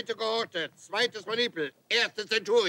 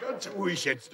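quiet; 7 dB quieter than usual. Acted. Sergeant calms himself after yelling.

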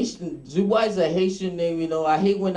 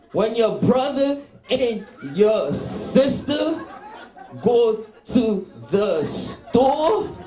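high, slow, scolding West Indian mother; 3 semitones higher, much slower than usual. Retold or imitated. West Indian mother scolding her son for stealing candy, slow high scold. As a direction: scold each word slowly, like a mother in the store. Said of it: "you know West Indian parents".

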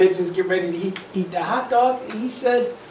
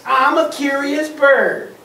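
higher, slow mocking imitation; mocking pigeon; 6 semitones higher, 4 dB louder, slower than usual. Upset pigeon mimicking the duck, mocking. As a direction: throw the words back in a mock singsong. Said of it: "He's really upset".

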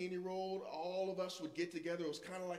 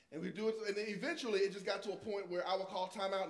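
ordinary delivery

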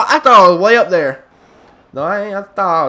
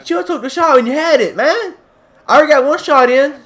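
frustrated yelling; 5 semitones higher than usual. Real, not acted. Raging at teammate in game.

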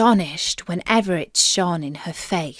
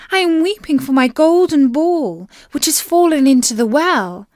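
lightly voiced princess, raised pitch; a touch of lament in a higher voice; 5 semitones higher, 4 dB louder than usual. A narrator's reading. Princess telling the frog of her lost ball, mildly lamenting.

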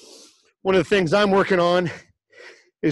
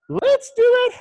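sung out high and bright; hype call. Real, not acted. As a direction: pump up the class, pitch way up. Instructor hyping up the class.

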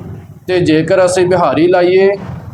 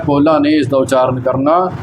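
ordinary delivery

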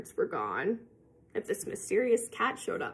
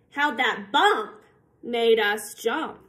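plain reading voice

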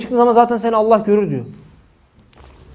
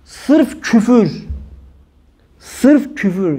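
very slow; much slower than usual. Shown as a demonstration.

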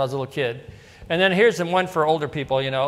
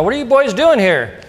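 a lightly voiced pastor, raised and louder; mock stern pastor asking the boys; a hint of a surprised pastor; pastor's question; 6 semitones higher, 6 dB louder than usual.

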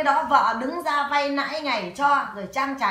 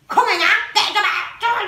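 higher, louder mock husband brushing it off; retold husband's shout; 8 semitones higher, 6 dB louder than usual. Husband dismissing her worries, higher and louder. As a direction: belt it out like the husband waving her off. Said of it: "Chồng mày còn bảo là".

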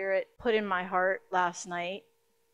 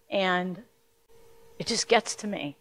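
ordinary talk, nothing marked

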